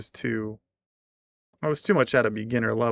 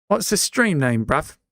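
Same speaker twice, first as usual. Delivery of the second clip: mock British; 4 semitones higher, 4 dB louder than usual.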